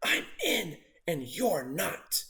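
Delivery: soft, slow taunting whisper; sly whisper. A narrator's reading. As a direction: hiss it just for Trevor. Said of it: "He whispered to Trevor".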